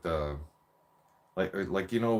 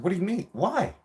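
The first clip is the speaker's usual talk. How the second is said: put-on shocked kid; 10 semitones higher than usual. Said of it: "I was like".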